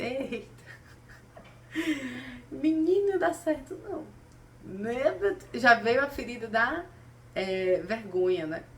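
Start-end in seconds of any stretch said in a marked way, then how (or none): 0.0-4.1 retold shocked outburst; startled exclamation. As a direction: react as if caught off guard, pitched up. Startled reaction to mocking joke.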